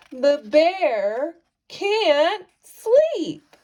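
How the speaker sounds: slow, drawn-out refrain. A narrator's reading. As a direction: stretch the refrain out slowly. Refrain read slowly.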